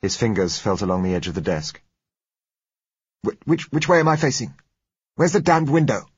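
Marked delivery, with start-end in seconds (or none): 3.2-6.1 high, loud, stammering irritation; irritable demand. A narrator's reading. Blind doctor demands his bearings from the patient, irritable. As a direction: demand it testily, stumbling at the start. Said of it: "he demanded with a return of irritability".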